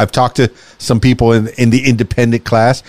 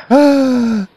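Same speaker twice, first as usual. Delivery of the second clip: sharp, high gasp of delight; excited gasp; 11 semitones higher than usual. Real, not acted. Delighted reaction to summer news.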